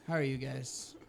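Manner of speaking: sigh, then a low, soft greeting; deflated, defeated opener; audible defeat. Retold or imitated. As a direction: imitate giving up on stage. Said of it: "just audible defeat".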